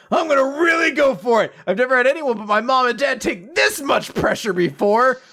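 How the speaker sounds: high, forceful, worked-up voice; hammed-up cry of effort; all-out determination. Masseur bearing down on her foot, straining and determined.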